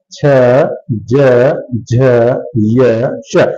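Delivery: low, drawn-out single sounds with pauses; palatal sound demonstration. Shown as a demonstration. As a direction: recite each palatal sound separately, deliberately. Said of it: "जब भी बोलेंगे तो जीवा हमारी वहां पर". (तालव्य वर्णों का अलग-अलग उच्चारण)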